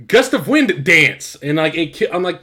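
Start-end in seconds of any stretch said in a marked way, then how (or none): 0.1-1.4 "Wind" punched, then "Dance" set apart; slow, loud "Gust of Wind Dance" with "Dance" split off; wrongly phrased move name. Retold or imitated. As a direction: say "Gust of Wind Dance" slowly, higher, breaking before "Dance". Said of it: "say it the same wrong way".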